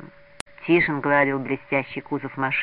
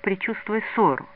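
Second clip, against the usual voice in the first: plain reading voice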